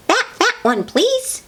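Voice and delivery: very high, squeaky piggy voice; pig character. A narrator's reading. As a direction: squeal it politely as a little pig. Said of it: "says the pig".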